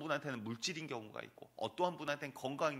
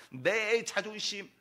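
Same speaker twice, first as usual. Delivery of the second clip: slow, drawn-out cry, much louder and higher; climactic shout; 8 semitones higher, 9 dB louder, much slower than usual. Acted. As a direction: land the last word like a hammer blow. Preacher builds list to climax.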